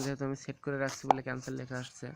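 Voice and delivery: ordinary delivery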